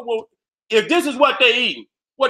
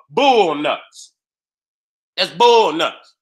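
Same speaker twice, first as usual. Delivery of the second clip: slow deadpan reveal; 5 semitones lower, much slower than usual. Real, not acted.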